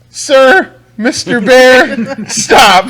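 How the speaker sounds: high, loud, slow plea; high drawn-out plea, laughing; pleading lady voice. Retold or imitated. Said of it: "You know what won't stop a grizzly bear?".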